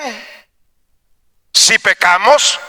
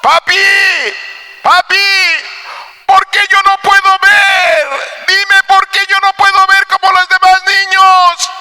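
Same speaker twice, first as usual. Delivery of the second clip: put-on high, loud pleading child's cry; sad little girl; 13 semitones higher, 3 dB louder than usual. Blind girl begs her father why she can't see, high tearful pleading. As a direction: beg daddy like a heartbroken blind child. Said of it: "triste le dice".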